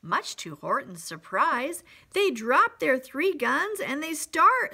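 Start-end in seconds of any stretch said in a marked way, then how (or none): none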